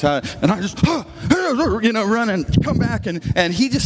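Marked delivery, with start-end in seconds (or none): 0.9-1.8 put-on quick high gasp of a boy jumping to obey; startled gasp. Nephew leaping up to grab the toolbox, gasping high. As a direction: gasp as if snapping to attention. Kid rushing for uncle's toolbox.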